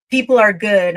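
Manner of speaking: people punched out loud, falling at the end; emphatic declaration. Real, not acted. Telling the host about strangers' kindness, loud and emphatic.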